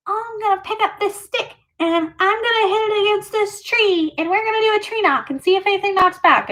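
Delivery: high, sing-song mocking of a teasing little sister. Imitating her sister teasing about the tree knock, high and mocking. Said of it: "and she was like".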